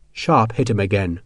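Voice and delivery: ordinary delivery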